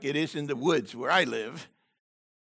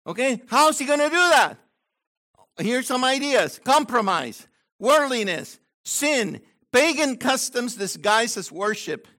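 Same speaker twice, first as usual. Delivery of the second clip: raised, urgent sermon voice; forceful preaching; 7 semitones higher, 6 dB louder than usual. Real, not acted. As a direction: drive the warning home to the congregation. Preaches at the congregation.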